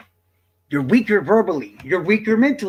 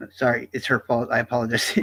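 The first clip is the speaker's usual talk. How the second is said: normal talking voice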